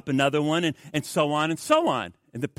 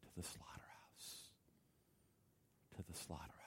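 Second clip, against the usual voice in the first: hushed whisper; 10 semitones lower, 32 dB quieter than usual. Real, not acted. Preacher warning the flock, whispering gravely. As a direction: breathe it like a dark warning.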